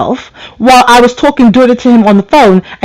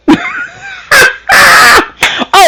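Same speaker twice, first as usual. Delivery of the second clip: shrieking laugh. Real, not acted. Host shrieks with laughter at her own punchline. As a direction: howl with laughter at your own joke.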